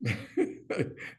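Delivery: a short high knowing laugh; imitated knowing laugh. Rabbi answering his student's question with a knowing laugh. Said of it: "He went like this".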